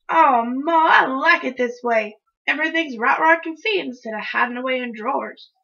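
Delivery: put-on loud, complaining kid; put-upon child's griping; whining protest. A narrator's reading. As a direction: object loudly to Ma. Child protests tidying room.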